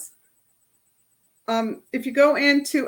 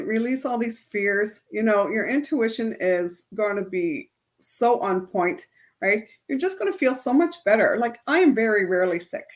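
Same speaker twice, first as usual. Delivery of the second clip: normal talking voice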